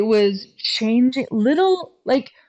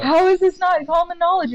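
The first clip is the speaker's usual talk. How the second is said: amazed; 9 semitones higher than usual. Retold or imitated. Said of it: "I'm like".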